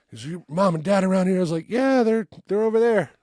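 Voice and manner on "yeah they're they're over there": put-on nervous kid. Kid nervously lying to guard.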